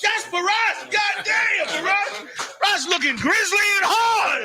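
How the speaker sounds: thrilled shout of greeting; excited shout. Real, not acted. Greeting returning guest with delight.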